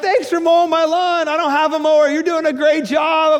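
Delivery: high loud gushing thanks; gushing neighbor. Retold or imitated. Neighbor thanking the pastor, high gushing. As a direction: gush gratitude.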